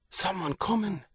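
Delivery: put-on raspy child's whisper; hoarse whisper. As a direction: rasp out a warning under breath. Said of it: "a hoarse whisper".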